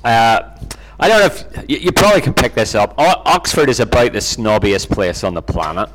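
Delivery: ordinary delivery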